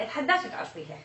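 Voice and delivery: usual speaking voice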